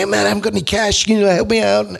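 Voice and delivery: high, quick begging voice of a broke lute player; mooching musician. Retold or imitated. Broke musician begging a mate for cash, pleading high and fast.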